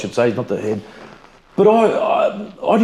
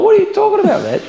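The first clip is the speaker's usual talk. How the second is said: much higher, louder mock disbelief; retold disbelief; 4 dB louder than usual. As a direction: squeal it out like you can't believe it. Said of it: "And I'm like".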